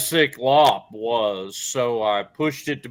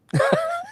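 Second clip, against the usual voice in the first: scream into giggles. Real, not acted. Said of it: "you scared the crap out of me".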